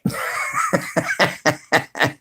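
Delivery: burst of laughter. Real, not acted. Laughing at chat joke.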